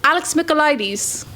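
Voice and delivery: mock London accent, mick-uh-LIE-deez; British accent. Shown as a demonstration. Host guessing from author living in London, British accent. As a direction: say the name in a playful British accent, laughing. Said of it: "You said it with the accent".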